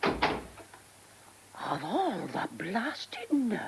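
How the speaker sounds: slow, hushed, outraged aside; indignant whisper. Acted. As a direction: mutter it to herself in fury. Indignant aside after vicar leaves.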